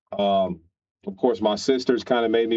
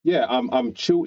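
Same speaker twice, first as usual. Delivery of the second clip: ordinary delivery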